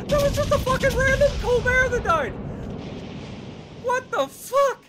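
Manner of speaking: loud, high, laughing disbelief; excited shout. Real, not acted. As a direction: shout it through amazement.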